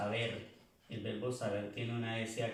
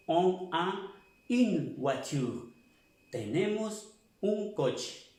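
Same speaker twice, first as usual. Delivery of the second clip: slow demo; 5 semitones higher, slower than usual. Shown as a demonstration. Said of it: "Pero este on".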